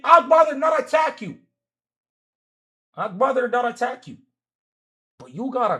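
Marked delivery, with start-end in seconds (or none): 0.0-1.4 shouted take. Real, not acted. Arguing, repeats line louder.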